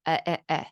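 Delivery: "eh, eh, eh" as short even vowels; vowel-only imitation. Shown as a demonstration. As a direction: say "eh, eh, eh" with no consonants or rise. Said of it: "for what's inside, I hadn't said".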